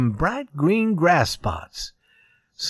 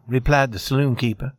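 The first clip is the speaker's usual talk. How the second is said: ordinary delivery, nothing marked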